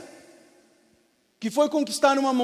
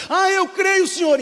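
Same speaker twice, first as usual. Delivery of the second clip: higher, louder fervent reply; eager declaration; 9 semitones higher, 8 dB louder than usual. Acted. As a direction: blurt out faith eagerly. Climber repeats his faith.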